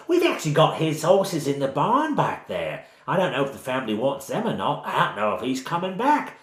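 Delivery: high, lively Cockney village woman; bad Cockney accent. Village woman telling strangers about the missing farmer, in bad Cockney. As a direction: gossip away in exaggerated Cockney. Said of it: "she has a sort of bad Cockney accent".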